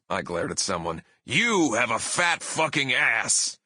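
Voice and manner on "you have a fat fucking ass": higher, slow, stretched heel insult; pro wrestler bellow. Retold or imitated. Singer glaring at someone in the crowd, wrestler bellow. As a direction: spell out the insult word by word. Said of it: "bellowed in my best professional wrestler voice".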